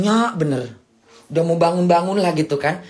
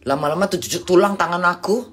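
ordinary talk

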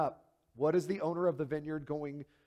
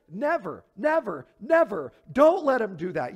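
each "never" louder than the last; emphatic never; 4 semitones higher, 8 dB louder than usual. Retold or imitated. Preacher voicing the crowd's rising cry of refusal, loud. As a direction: build three "never"s to a loud plea. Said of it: "an emphatic".

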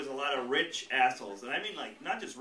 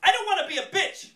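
high, loud mock huffy lady; mock angry customer; 10 dB louder than usual. Angry bakery customer holding back, huffy. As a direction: ham up a furious customer. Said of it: "she goes".

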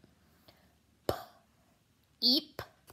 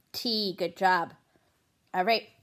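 ordinary delivery

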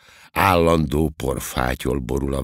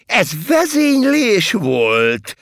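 high, loud, drawn-out astonishment; gleefully incredulous high exclamation; cheerful amazement; 6 dB louder, slower than usual. A narrator's reading. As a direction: marvel merrily at the news. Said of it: "csodálkozott vidáman".

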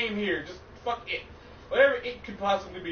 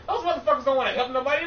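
ordinary talk, nothing marked

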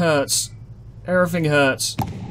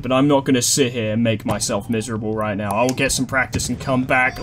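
usual speaking voice

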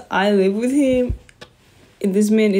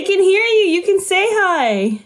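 much higher, teasing invitation; coaxing sing-song; 9 semitones higher than usual. Real, not acted. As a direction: sister urging her little brother to greet viewers. Coaxing brother to greet viewers.

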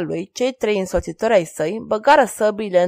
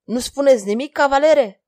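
sharp, louder demand, rising on cavalere; vague anger; 5 semitones higher, 5 dB louder than usual. A narrator's reading. King demanding an answer from the knight, angrily. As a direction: press him with barely held anger. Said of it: "cu o mânie nedefinită".

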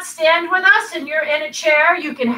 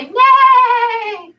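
high loud yay; silly cheer; 9 semitones higher, 5 dB louder than usual. Pretend. Teacher teasing kids in cat pose, high loud mock cheer. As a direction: cheer it out to tease.

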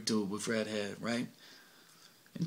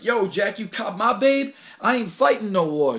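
higher, louder indignant protest; angry refusal; 7 semitones higher, 5 dB louder than usual. Acted. Achilles protesting over his girl, angry. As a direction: huff at the boss.

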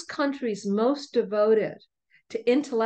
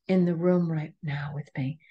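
whispered, as if sharing a secret; awed whisper; 3 semitones lower than usual. Retold or imitated. Inner thought about ancestor women.

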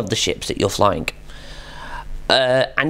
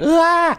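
panicked yelp; 3 dB louder than usual. Retold or imitated. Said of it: "you're like".

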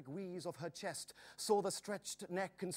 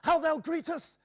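high, loud joyful shout; excited cry; 11 semitones higher, 8 dB louder than usual. A narrator's reading. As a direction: burst out at the sight of the town. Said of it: "gasped Joris".

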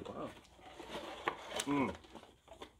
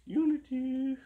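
high sing-song; 12 semitones higher, slower than usual. Pretend. Echoing high exclaim.

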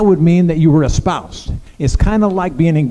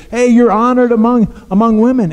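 a lifted, bright call, higher and a touch louder; excited angel greeting; 7 semitones higher, 3 dB louder than usual. Retold or imitated. Preacher voicing the angel's greeting to Mary, raised and eager. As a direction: hail her like a herald.

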